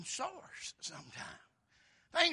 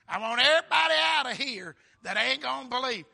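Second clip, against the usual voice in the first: loud, raised preacher's command; commanding shout; 5 semitones higher, 13 dB louder than usual. Acted. Jesus clearing doubters from the room, commanding shout. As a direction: order the whole room out.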